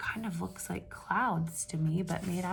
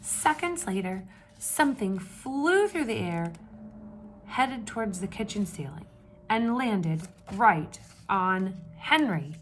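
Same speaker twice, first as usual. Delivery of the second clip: steady read-aloud storytelling voice; story narration; 4 dB louder than usual. A narrator's reading. Teacher reading a picture book to the class, storytelling voice.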